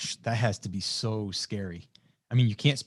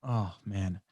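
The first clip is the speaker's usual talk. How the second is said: usual speaking voice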